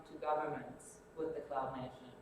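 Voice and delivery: normal talking voice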